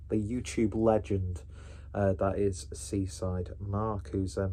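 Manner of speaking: usual speaking voice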